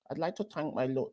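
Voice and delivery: soft, high mock Trinidadian accent; attempted Trini accent. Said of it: "I can't do the Trini accent".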